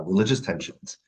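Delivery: usual speaking voice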